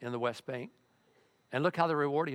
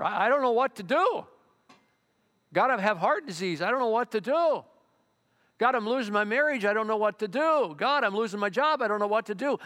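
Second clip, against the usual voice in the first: loud, high pleading cries to God; desperate prayer; 6 semitones higher, 4 dB louder than usual. Pretend. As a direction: beg God for help, voice raised and rising. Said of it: "That's you sometimes".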